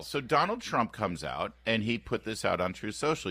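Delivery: ordinary talk, nothing marked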